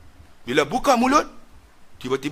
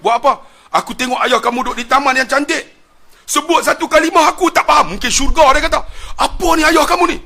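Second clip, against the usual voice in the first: higher, louder, urgent questioning; excited mother; 11 semitones higher, 7 dB louder than usual. Retold or imitated. Mother demanding to know what son did, excited. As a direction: press the son with alarm.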